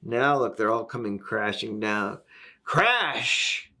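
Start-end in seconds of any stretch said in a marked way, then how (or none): 2.6-3.7 high, punchy storybook crash; capital-letters crash. A narrator's reading. Reader voices everyone crashing down, big emphatic crash. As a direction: hit it like a sound effect. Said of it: "Big capital letters".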